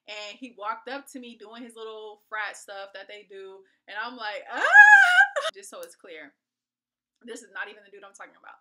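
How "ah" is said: high loud squeal; excited squeal. Retold or imitated. As the cute guy walks up, a loud high squeal. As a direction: squeal like you just spotted him.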